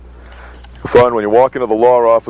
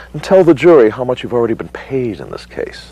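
lightly mocking cross-examination; mild sarcasm; 3 semitones higher than usual. Opposing counsel impeaching the expert witness, mildly sarcastic. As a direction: needle the witness with a touch of sarcasm.

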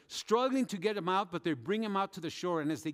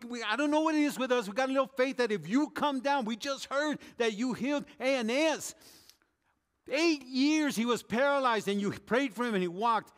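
higher, louder preaching plea; stirring sermon voice, pleading; fervent preaching; 6 semitones higher, 4 dB louder than usual. Acted. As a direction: build the plea with rising fervor. Preaching, voicing disciples' plea.

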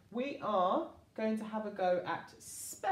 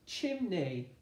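ordinary talk, nothing marked

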